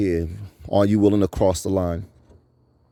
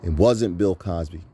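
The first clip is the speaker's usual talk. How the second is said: usual speaking voice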